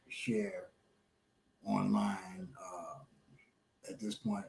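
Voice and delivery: hushed whisper. Real, not acted. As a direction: drop to a whisper. Withholding info, drops to whisper.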